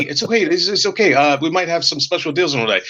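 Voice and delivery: mock exec downplaying a gaffe; panicked backpedal. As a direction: scramble to take it back, then soothe. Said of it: "And then Don Matrix said".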